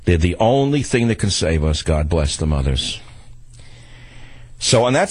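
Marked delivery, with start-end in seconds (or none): 1.8-3.0 slower, hushed "God bless the mothers". Real, not acted. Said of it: "repeated blessing".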